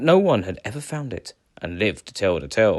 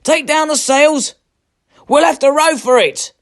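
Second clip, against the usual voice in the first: loud high order; 8 dB louder than usual. A narrator's reading. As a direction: bark orders over the storm, high and loud. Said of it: "called out Eric".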